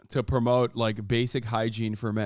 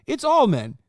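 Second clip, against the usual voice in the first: emphatic; 10 semitones higher, 5 dB louder than usual. Real, not acted. Arguing hard with a commenter.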